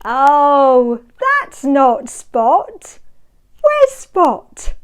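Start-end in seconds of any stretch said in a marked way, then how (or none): none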